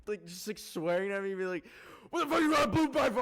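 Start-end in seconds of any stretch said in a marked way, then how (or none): 2.1-3.2 fast, high mock shout; hammed-up outraged yell; imitated angry driver. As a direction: holler it like a stranger swearing from a car. Acting out swearing driver.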